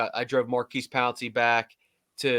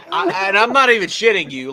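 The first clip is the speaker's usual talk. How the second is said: laughing; 7 semitones higher, 8 dB louder than usual. Real, not acted. Laughing while telling story.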